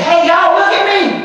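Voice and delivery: high-pitched attention-seeking holler; mock show-off. Mocking a consultant boasting to the team, high show-off call. Mocks showing off at shows.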